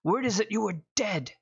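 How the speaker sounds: lightly voiced old miner, surprised and glad; a touch of a delighted old man.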